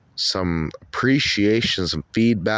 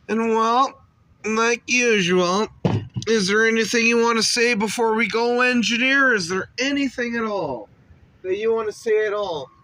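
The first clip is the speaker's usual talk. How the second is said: higher and louder host voice; put-on interviewer voice; 10 semitones higher, 4 dB louder than usual. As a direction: quiz the engineer like a talk show host. Interviewer voice addressing engineer.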